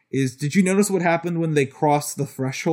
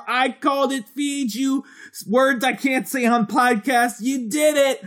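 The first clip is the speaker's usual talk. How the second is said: high, slow, booming all-caps read; shouting capitalized words; 11 semitones higher, slower than usual. Acted. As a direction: announce it like a hype man. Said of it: "shall I read it in all caps".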